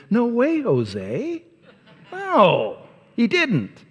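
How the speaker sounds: high playful "No way", dropping on "Jose"; breezy modern brush-off; casual emphatic no. Retold or imitated. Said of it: "Or even more, you know, more updated".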